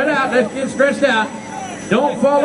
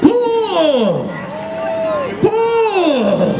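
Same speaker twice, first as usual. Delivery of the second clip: shouted count; 8 semitones higher, much slower than usual. Real, not acted. Count-off for rope pull.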